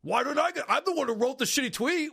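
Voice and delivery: high, whiny mockery of an aggrieved man; mock indignant complaint. Host mocks a rival upset over super chat money, indignant. Mocking John's jealous complaint.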